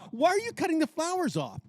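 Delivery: high, exasperated demand; retold outburst. Confronting her mother cutting off the flowers, high and exasperated. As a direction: throw the question at her in disbelief. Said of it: "I said, what the fuck".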